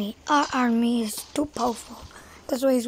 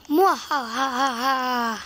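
slow evil cackle; villain laugh, drawn out; mad scientist; slower than usual. Acted. Villain plans to conquer.

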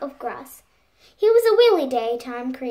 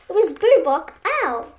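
ordinary delivery

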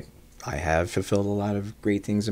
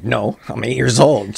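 put-on louder child's protest; exasperated kid; 4 semitones higher, 9 dB louder than usual. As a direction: whine back like an annoyed eight-year-old. Child answering mom.

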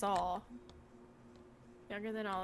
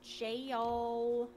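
"Jail" stretched long and level; clarified word. Shown as a demonstration. Correcting a misheard word, drawn out and clear. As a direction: draw out "Jail" so the vowel is clear. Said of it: "it sounded like you said gel".

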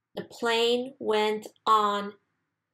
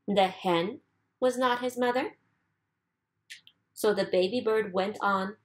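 usual speaking voice

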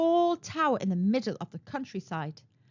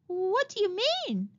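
higher, bewildered question; puzzled; 9 semitones higher than usual. A narrator's reading. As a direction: she cannot follow him.

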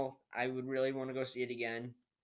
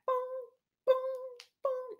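hammer booms; 6 dB louder than usual. Retold or imitated. Imitating hammer strikes.

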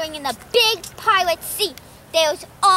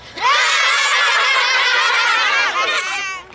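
big burst of laughter; 4 semitones higher than usual. Real, not acted. Laughs at joke.

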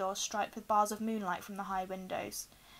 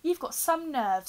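loud, higher, drawn-out protest; loud indignation; 6 semitones higher, 7 dB louder, slower than usual. A narrator's reading. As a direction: say it loudly and slowly, affronted. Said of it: "said Ron loudly".

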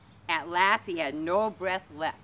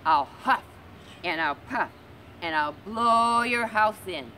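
louder, slow menacing threat; loud wolf threat; 4 semitones higher, 6 dB louder than usual. Acted. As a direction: blast the threat at the pig. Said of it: "the wolf said loudly".

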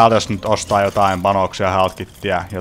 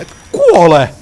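shouted frustration; 6 semitones higher, 6 dB louder, much slower than usual. Real, not acted. Fighting enemy in game.

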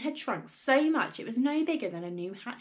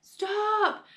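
high, loud "stop"; sudden urgent shout; alarmed cry; 12 semitones higher, 5 dB louder than usual. A narrator's reading. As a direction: cry out to halt it. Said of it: "cried Missus Cranky".

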